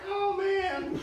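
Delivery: put-on country Southern drawl; southern twang. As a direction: play a hillbilly with a Southern twang. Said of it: "like, a southern twang now".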